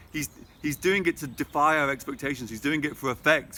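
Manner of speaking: ordinary talk, nothing marked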